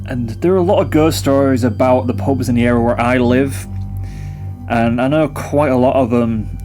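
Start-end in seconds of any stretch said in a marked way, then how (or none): none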